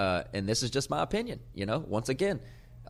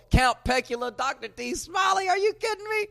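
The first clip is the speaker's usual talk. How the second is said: hyped shout-outs. Real, not acted. Host excitedly yelling shout-outs to his viewers. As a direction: hype the crowd, shouting every name.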